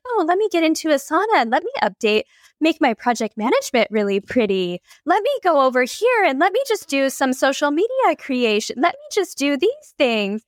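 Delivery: high, sing-song busywork voice, slowing at the end; mock ego voice. Mocking her own procrastinating ego, high and sing-song. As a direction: mimic your stalling ego, cheerfully avoiding the real work. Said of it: "And I was like".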